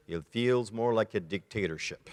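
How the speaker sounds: low, dry deadpan with a laugh; dry retold reply. Husband answering his texting wife, dry and deadpan. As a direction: deliver the punchline flat and low. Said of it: "To which he answered".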